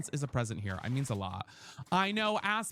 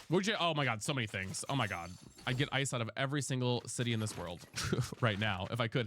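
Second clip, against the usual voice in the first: normal talking voice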